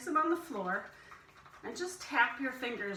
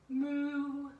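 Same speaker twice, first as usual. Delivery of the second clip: long, gentle cow moo; an easy, drawn-out moo. Shown as a demonstration. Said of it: "moo like a cow".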